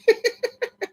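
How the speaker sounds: high squeaky laugh; helpless laughter. Real, not acted. Tickled by Larry's correction, high giggling.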